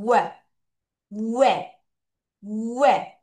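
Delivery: 왜 as one rounded "weh" syllable; syllable demo. Shown as a demonstration. Teacher has pupils repeat the first syllable, 왜 pronounced "weh". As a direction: say 왜 in one go, "w" gliding into "eh". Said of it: "W E A 한꺼번에 발음하게 따라하세요".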